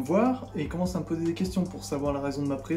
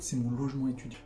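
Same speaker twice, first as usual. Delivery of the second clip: whisper; 4 semitones lower, 5 dB quieter than usual. Real, not acted. Aside about the student housing.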